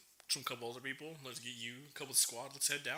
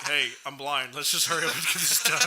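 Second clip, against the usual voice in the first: louder impatient retort; 10 dB louder than usual. Retold or imitated. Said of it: "I'm like".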